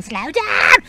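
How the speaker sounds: high, loud yelp of alarm; panicked shout. Retold or imitated. In a car with no seatbelts, yelling at the driver: high panicked shout. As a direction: yell at the driver to slow down. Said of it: "I was going".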